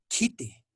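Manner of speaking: "kite" with a puff of air after the k; aspirated K. Shown as a demonstration. Teacher giving an Aymara KH example, aspirated K. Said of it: "fonema K H".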